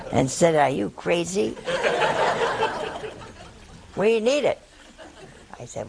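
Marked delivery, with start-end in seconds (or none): 0.6-4.6 laughing through a retold scolding, rising higher at the end; laughing mock scold. The nun retells her mother's outrage, laughing.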